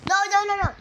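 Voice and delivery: high loud protesting no's. Real, not acted. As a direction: push back at a spot stealer. Someone takes her spot.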